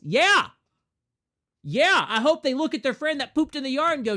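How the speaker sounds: loud, high, emphatic yeah; emphatic yell. Real, not acted. Streamer yells back at imagined critics, loud and high. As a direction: shout it back defiantly.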